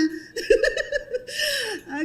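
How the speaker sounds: high, bright giggle. Real, not acted. Mother teasing the couple about the car, high giggle.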